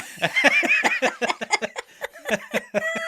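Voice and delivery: long, high-pitched laugh; helpless laugh at a funny pronunciation; laughing fit. Real, not acted. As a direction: burst into prolonged high giggling.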